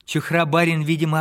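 ordinary delivery

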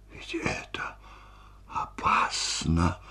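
slow, breathy whisper of dread; horrified whisper. A narrator's reading. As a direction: whisper it slowly, chilled with horror. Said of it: "холодея от ужаса". (медленным шёпотом, в ужасе)

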